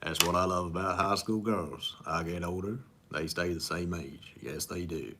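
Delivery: lightly voiced Southern charmer, unhurried; corrected second take of the movie line; Matthew McConaughey impression. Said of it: "Matthew McConaughey, uh, impression".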